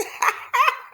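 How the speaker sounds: very high teasing cackle; high mocking cackle. A narrator's reading. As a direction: laugh right at him. Melinda laughing at Harry.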